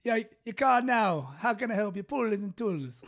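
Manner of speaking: put-on Jamaican accent. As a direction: answer the phone in mock Jamaican. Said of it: "you do the Jamaican one".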